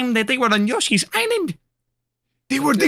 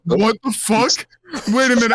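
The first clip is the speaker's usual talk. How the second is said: ordinary talk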